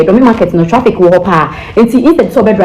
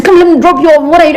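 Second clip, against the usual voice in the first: pushy driver, pitched higher; mock the insistent driver, up high; imitating pushy driver; 8 semitones higher than usual. Woman mimics driver insisting she gets in, pitched up. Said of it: "Or say".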